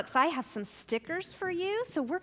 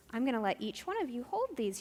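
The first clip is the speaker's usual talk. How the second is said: usual speaking voice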